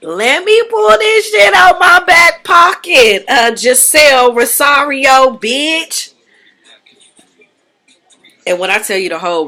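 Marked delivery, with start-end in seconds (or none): 0.0-6.1 high, loud taunting reveal shouted at the room; mocking Nicole. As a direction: cast member calls out her rival's secret, shrill and loud. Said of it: "that leads into Nicole, like".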